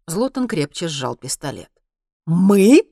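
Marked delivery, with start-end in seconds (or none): 2.2-2.8 loud, higher, rising disbelief; startled question. A narrator's reading. Zlotan reacts to Henry not being alone, startled. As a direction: snap back the word in surprise. Armed soldier startled by the plural.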